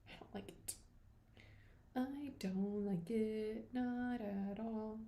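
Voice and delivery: soft, slow sulky murmur; hushed pout. Real, not acted. To viewers about the quiet room, soft slow sulk. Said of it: "I don't like that".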